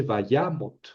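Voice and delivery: vayamot with stressed YA, unstressed mot; va-YAA-mot. Shown as a demonstration. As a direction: let mot fall away after YA in vayamot. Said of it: "una sílaba cerrada sin tonos, átona".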